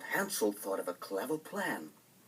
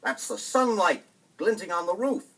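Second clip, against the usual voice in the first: scornful stepmother; 7 semitones higher, 8 dB louder than usual. Acted. Stepmother scolding Hansel.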